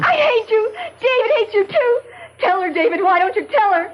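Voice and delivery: high, crying accusation; tearful outburst. Acted. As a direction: sob it out at her in spite. Iris crying out in jealous hurt.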